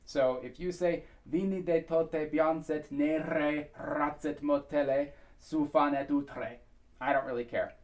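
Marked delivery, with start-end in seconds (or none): 1.2-6.6 slow lyric demo, R rolled in "nere" and "utre"; rolled R's. Shown as a demonstration. Coach showing which R is acceptable, slow and rolled. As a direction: roll the R's in "nere ratzet motele". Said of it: "they're rolled R's".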